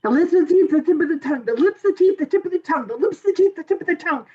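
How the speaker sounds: rattled-off vocal warm-up chant; quick tongue twisters. Acted. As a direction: race through repeated tongue twisters without pausing. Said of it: "tongue twistering quickly".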